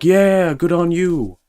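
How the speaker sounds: high, loud mock praise; cheering coworkers. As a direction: ham up the cheering. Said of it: "They were like".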